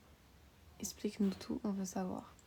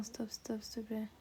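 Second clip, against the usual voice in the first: whispering; 8 dB quieter than usual. Real, not acted. Pleading before a sneeze.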